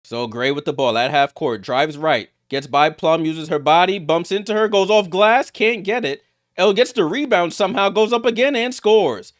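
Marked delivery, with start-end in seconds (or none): 2.5-9.3 high, energetic running commentary; excited play-by-play. Real, not acted. Commentator calls a scramble under the basket, excited. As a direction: ride the action up to the score.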